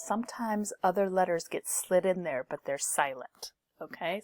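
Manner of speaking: ordinary delivery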